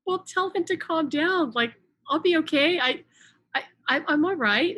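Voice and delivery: acted plea to stop worrying; insistent reassurance. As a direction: brush away their concern, voice lifted. Retold protest that she is fine.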